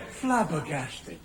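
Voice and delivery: low, slow and soft, mock-dramatic; hushed astonishment. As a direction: drop to a slow, low, awed hush. Narrator acts out the astonishment.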